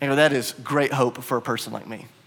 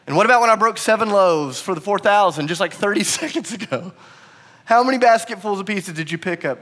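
raised, exasperated questioning; upset; 5 semitones higher, 3 dB louder than usual. Acted. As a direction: quiz them as if they should know. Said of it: "Jesus is upset".

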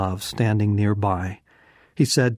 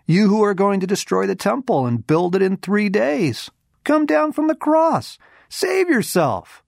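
much higher, louder taunting voice; jeering mockers; 10 semitones higher, 4 dB louder than usual. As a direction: jeer at him like a scornful crowd. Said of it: "hurled insults at him".

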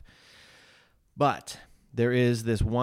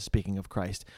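ordinary talk

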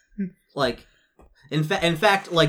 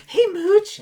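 high character voice; 8 dB louder than usual. Acted. GM voices NPC greeting player.

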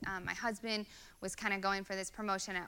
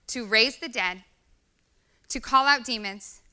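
higher, louder preaching build; preaching at full force; 4 semitones higher, 8 dB louder than usual. Acted. Pastor urging the congregation on their purpose, preaching hard. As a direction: drive the point home to the church. Preacher drives home the point.

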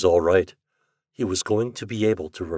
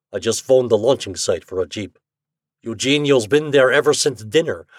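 put-on Russian accent; 6 semitones higher, 5 dB louder than usual. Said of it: "Pitov was speaking German".

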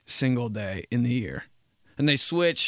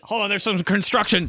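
raised-pitch phone voice; harried caller; 6 semitones higher, 3 dB louder than usual. Acted. Caller excusing noise on the line, harried. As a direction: call over imagined noise.

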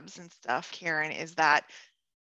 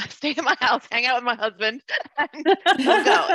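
laughing through it, pitched higher and louder; giggling while retelling the invitation; laughing as she repeats her friend's offer; 5 semitones higher, 8 dB louder than usual. Real, not acted.

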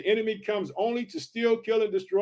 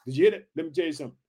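usual speaking voice

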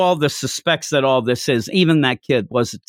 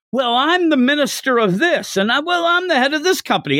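mock yelling bigwigs; 12 semitones higher than usual. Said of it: "they're all yelling like".

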